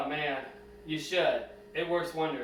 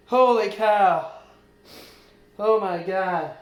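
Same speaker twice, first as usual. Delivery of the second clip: loud, slow, drawn-out exclamations; blurting out amazement; real loud astonishment; 2 semitones higher, 9 dB louder, slower than usual. Real, not acted. Metal finally out of leg.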